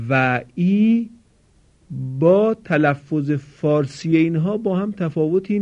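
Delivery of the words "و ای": و ای drawn out slowly with the ای held long; long vowel demo. Shown as a demonstration. Teacher repeating the long vowel ای for students, slow and held. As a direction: hold the ای in و ای like a model sound for the class. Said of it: "تلفظ صداهای کشیدهٔ او".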